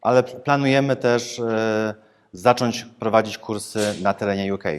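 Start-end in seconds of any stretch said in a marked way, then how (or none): none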